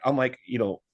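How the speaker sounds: ordinary delivery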